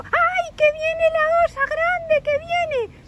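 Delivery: very high, squeaky cry of alarm; scared squeal. Acted. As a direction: squeal in mock fright like little children. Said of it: "los niños se asustan un poco".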